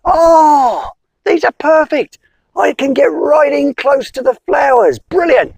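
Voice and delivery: high, bright squeaky joy; delighted bee. Acted. Bee thanking the wizard, high and delighted. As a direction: cheer like a tiny happy bee.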